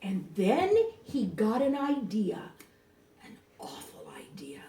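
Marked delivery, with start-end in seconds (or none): 3.2-4.6 sinister hushed whisper. A narrator's reading. Narrator revealing the Grinch's wicked plan, whispered.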